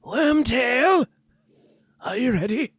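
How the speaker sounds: high, eerie Dark Lord; Voldemort voice. Acted. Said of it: "The Voldemort voice".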